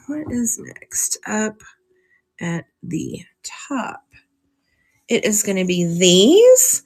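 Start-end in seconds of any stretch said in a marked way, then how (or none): 0.1-4.0 whispered to herself, slow. Real, not acted. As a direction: breathe it under her breath. Mutters to herself while looking.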